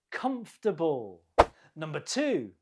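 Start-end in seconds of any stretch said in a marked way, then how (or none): 0.1-1.2 "Comfortable" with the first syllable stressed, the rest reduced. Shown as a demonstration. Teacher models the word in a pronunciation round, first-syllable stress on "Comfortable". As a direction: model "Comfortable" as three syllables, COMF-ta-ble. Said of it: "The stress is on the first syllable".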